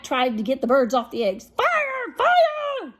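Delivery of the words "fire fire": very high, loud alarm cry; cat crying fire. Acted. Jack to the birds, shouting a false fire alarm high. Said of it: "he cried".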